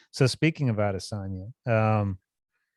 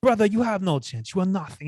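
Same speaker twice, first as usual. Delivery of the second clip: higher put-on Dagestani-accented taunt; lightly voiced fighter dismissing his rival, pitched up; mock fighter trash talk; 7 semitones higher, 3 dB louder than usual. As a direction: sneer it at the opponent in a high foreign accent. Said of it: "he just started going at him".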